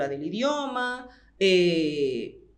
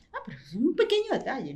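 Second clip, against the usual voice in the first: higher, quick mimicked brush-off; put-on higher voice, swooping and fast; dismissive learner; 5 semitones higher, much faster than usual. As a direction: imitate someone waving off a small detail, quickly. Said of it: "La gente que no estudia idiomas piensa".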